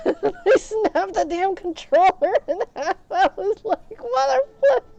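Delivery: high, loud raging voice with laughter; worked-up squealing outburst; re-enacted rage. Re-enacting his rage at the game.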